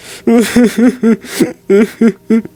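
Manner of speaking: high, loud evil cackle; villain cackle. Pretend. Gamer taunting a villager with a cackle.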